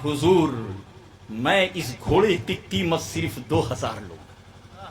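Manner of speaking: low, soft, deferential reply; humble seller, acted. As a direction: answer the master meekly. Seller humbly answers the saint.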